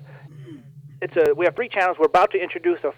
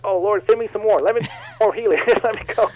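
higher, louder, laughing mock plea; smiling eager plea; 5 semitones higher, 5 dB louder than usual. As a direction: act out an eager prayer through a smile. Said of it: "I'm smiling like".

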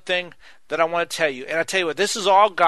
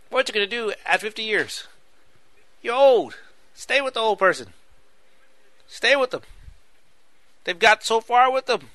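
mock teasing; 5 semitones higher than usual. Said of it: "I'm just playing".

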